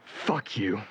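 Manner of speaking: low, soft defeated curse; broken curse. Acted. Dean giving up before his father, broken quiet curse. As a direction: let it out with no fight left. Broken after gun offered.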